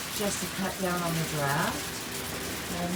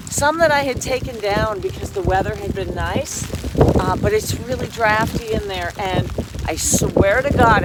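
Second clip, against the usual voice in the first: raised voice outside in the storm; loud over wind; 3 semitones higher, 8 dB louder than usual. Real, not acted. Outdoors talking over incoming storm.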